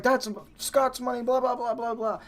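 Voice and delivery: high mock rant dissolving into blahs; imitating manager flipping out. Manager losing his mind on the cook, imitated in a high mock rant. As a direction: rattle off a high-pitched, outraged scolding. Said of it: "starts losing his mind on him".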